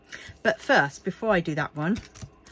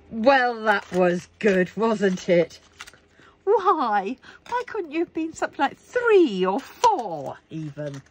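high, louder sarcastic complaint; mock exasperation; 5 semitones higher, 3 dB louder, slower than usual. Real, not acted. Scolding the dice for a bad number, mock exasperated. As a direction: whine at the dice in mock despair.

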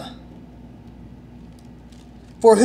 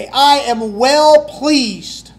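slow, louder, higher; the Father's pleased words, drawn out; slow proclamation; 5 semitones higher, 5 dB louder, much slower than usual. Acted. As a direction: proclaim it slowly with weight.

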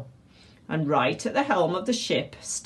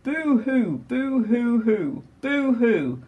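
high, wailing boo-hoos; mock sobbing; 6 semitones higher, slower than usual. A narrator's reading. Narrator voices a crying pirate for listeners, mock sobbing. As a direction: draw out each storybook sob.

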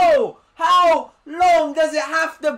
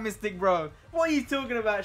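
ordinary talk

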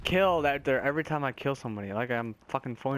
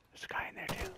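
whisper; 12 dB quieter, faster than usual. Real, not acted. Spotting another enemy.

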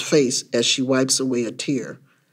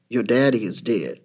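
lower-pitched, subdued delivery; grave mother; 6 semitones lower, 4 dB quieter than usual. A narrator's reading. Mama telling her son of a death, subdued. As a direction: break bad news gently.

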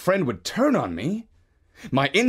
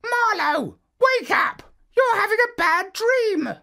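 much higher squawky bird shouting wake up; friendly bird voice. Acted. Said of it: "the friendly voice of a bird saying".

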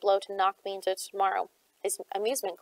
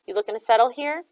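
louder, sarcastic jab; mock the gang with a sneer; 3 semitones higher, 7 dB louder than usual. A narrator's reading. Shade needling his gang to hurry, sarcastic. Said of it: "he asked sarcastically".

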